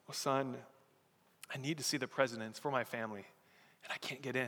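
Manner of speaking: soft, low and defeated; mildly acted sadness, quietly spoken; dejected, tearful soldier. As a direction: sigh it out, understated despair. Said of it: "can see that he's crying".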